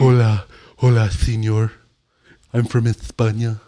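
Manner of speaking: hammed-up Spanish accent, lisping. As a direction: play a cheesy Spaniard saying hola. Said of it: "Spain people talk with a lisp".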